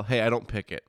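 ordinary talk, nothing marked

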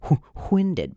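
"winded" begun with a separate puff of H; hard H. Shown as a demonstration. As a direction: blow the H, then say "winded". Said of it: "Hard H".

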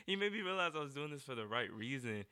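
said through a laugh. Real, not acted. To the interviewer about the DJ's kind words, laughing.

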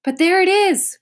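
high, loud excited cry. A narrator's reading. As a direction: call out in sudden joy. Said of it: "cried Milo".

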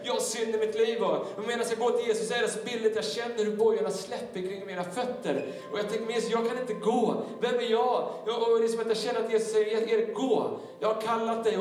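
high, urgent, driving climax; impassioned preaching. Acted. Preacher voicing his dialogue with Jesus for the congregation, impassioned. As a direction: build it with sustained pulpit projection.

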